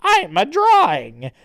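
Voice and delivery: high, loud sudden discovery; hammed-up hero epiphany; cartoon realization. Falling hero opens his eyes, loudly realizing. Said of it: "opens his eyes and is like".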